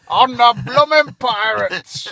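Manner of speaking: slow loud pirate roar. Pretend. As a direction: ham it up like a pirate. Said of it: "in a pirate voice".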